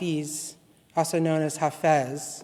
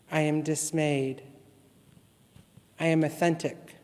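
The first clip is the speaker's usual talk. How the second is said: litany reading; 4 dB quieter than usual. Acted.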